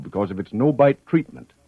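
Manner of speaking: usual speaking voice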